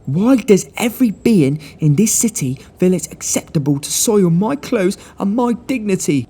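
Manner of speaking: a posh man outraged at his soiled clothes; indignant gentleman. Acted. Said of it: "he cried".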